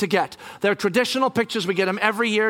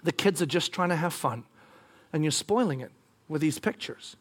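low, soft, gentle reproach; real nice; 6 semitones lower, 7 dB quieter than usual. Retold or imitated. Wife reasoning with husband about kids, real nice. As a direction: say it softly and kindly. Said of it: "she says it real nice".

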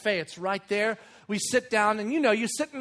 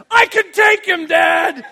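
put-on loud, high, fierce little-kid shout; excited four-year-old boy; 8 semitones higher, 14 dB louder, slower than usual. Son to dad about the dinosaur, fired-up shouting. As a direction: shout it like a boastful small boy. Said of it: "He looks at me and goes".

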